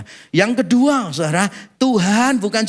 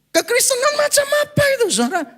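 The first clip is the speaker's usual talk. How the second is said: outraged disbelief; 14 semitones higher, faster than usual. Real, not acted. Outraged rhetorical question.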